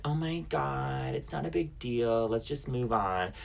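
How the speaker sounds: slow, soft, high-pitched brush-off; mock them waving it away, drawn out and quiet; dismissive. Friend brushing off the fight in the store, dismissive. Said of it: "completely dismissing the whole thing".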